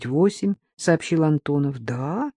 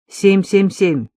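crisp radio call; 3 semitones higher, 4 dB louder, slower than usual. Acted. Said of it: "поднял губам микрофон".

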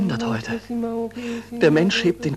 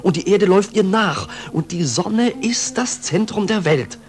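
ordinary talk

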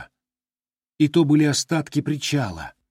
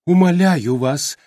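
slow, louder lament; wailing plea; 5 semitones higher, 5 dB louder than usual. A narrator's reading. The giant dragonfly pleading with Glimung, wailing. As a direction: beg with a wail. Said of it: "запричитала огромная стрекоза".

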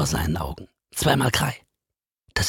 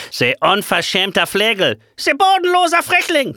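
shrill, much higher, loud outraged yell; furious yelling teacher; 10 semitones higher, 5 dB louder than usual. Acted. The teacher yells at his double, much higher and louder. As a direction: bellow insults at the impostor. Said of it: "tönt es zurück".

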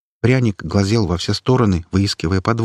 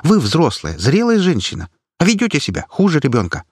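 scolding; 9 semitones higher than usual. Acted. Activist lecturing granny.

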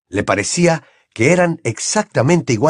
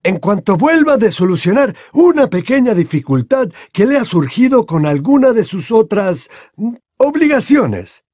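a raised, courteous headmaster; Dumbledore voiced; 10 semitones higher, 4 dB louder than usual.

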